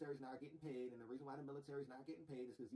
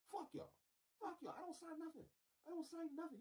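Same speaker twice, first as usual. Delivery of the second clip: defiant outburst; 13 semitones higher than usual. Acted.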